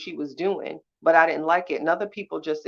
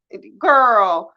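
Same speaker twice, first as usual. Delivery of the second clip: sudden loud higher exclamation; exclaiming; 6 semitones higher, 12 dB louder than usual. Real, not acted. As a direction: break off with a loud burst. Cuts herself off mid-rant.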